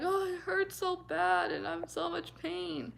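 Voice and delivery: high, slow, whimpering self-pity; mock whiny self. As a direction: whine it out like a hurt kid, slowly. Mimics her own past self crying.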